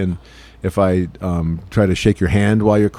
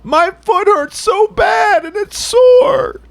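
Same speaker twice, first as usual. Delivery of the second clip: very high, slow wail; loud, drawn-out fake blubbering; mock pained crying; 5 dB louder, slower than usual. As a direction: bawl like a hurt child, exaggerated. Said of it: "it's not that kinda".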